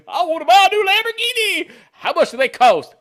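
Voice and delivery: loud, high-pitched naive customer; hammed-up clueless buyer voice; eager car buyer. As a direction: burst out with overexcited shopper's eagerness. Acts out penniless Lamborghini customer.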